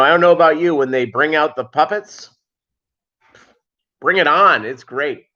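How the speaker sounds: usual speaking voice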